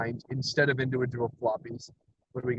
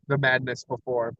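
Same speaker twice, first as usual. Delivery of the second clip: usual speaking voice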